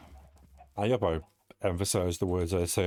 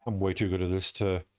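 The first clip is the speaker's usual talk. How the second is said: ordinary delivery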